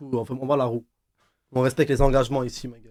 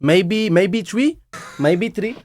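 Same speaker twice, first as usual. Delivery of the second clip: slow, higher-pitched mock Indian English; Indian accent; 4 semitones higher, much slower than usual. Shown as a demonstration. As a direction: hesitate in a put-on Indian accent. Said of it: "avec un accent indien". (accent indien imité, lent et hésitant)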